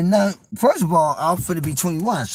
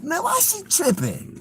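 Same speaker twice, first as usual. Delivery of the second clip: inner thought; 11 semitones higher, slower than usual. Retold or imitated. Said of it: "I'm also at the time thinking like".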